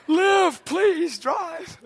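high, loud mock plea, fading at the end; pleading with truck. Preacher begging his old truck to live, mock pleading. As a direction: beg the old truck to keep going.

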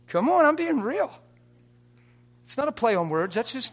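high, loud, pleading insistence; insistent preaching. Acted. Preacher pressing the congregation to accept, loud and high. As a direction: make them believe you.

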